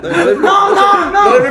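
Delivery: high, slow laughing shriek; laughing shout. Real, not acted. Friends tease over embarrassing photo.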